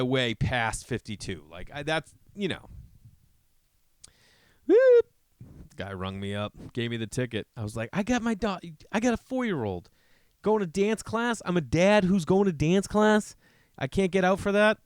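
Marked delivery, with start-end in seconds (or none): 4.7-5.1 loud high whoop like a police siren. Retold or imitated. Dad imitating the motorcycle cop's siren, loud whoop. 7.9-14.8 mock pleading. Pleading to cop, higher.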